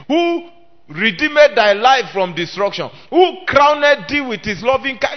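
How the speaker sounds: loud preaching that piles up the blessings; fervent preaching. Acted. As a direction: rattle off God's benefits with fire. Preaching list of benefits loudly.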